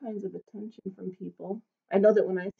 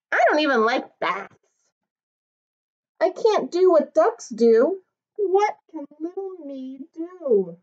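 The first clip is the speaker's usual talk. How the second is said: higher, small wistful voice of a tiny dog; little dog Norbert, wistful; 6 semitones higher, 4 dB louder than usual. Acted. Tiny dog wishing he were big, higher and wistful. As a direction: sound small and longing.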